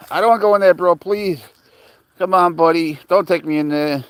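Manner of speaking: lightly acted whiny plea; pleading. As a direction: plead like a reluctant buddy. Acted plea in the dog's story.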